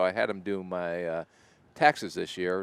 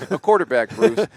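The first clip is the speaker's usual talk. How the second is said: loud, high announcer call of "The quarterback, Bruce"; 7 semitones higher, 11 dB louder than usual. Acted. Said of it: "go broadcast the Bruce quarterback".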